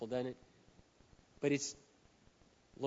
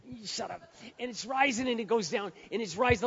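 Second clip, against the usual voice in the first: straining to hold back; 8 semitones higher than usual. Acted. Acts out anger gurgling up inside.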